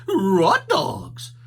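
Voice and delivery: high, loud dog voice; Scooby voice. A narrator's reading. Scooby excited about chocolate, high and loud. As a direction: say it like a hungry cartoon dog.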